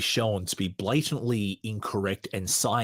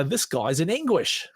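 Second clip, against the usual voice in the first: higher-pitched mock New Zealand accent; Ray Comfort, bad Kiwi accent; 7 semitones higher than usual. Podcaster imagines the preacher exclaiming, badly faked Kiwi accent. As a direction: lightly put on a poor Kiwi accent, pitched up. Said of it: "that's my really bad Kiwi accent".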